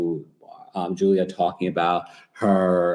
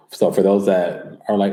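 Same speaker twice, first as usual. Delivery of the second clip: usual speaking voice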